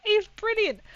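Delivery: high, loud retold enthusiasm; delighted praise. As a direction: gush loudly, pitched well up. Retelling her own delighted reaction.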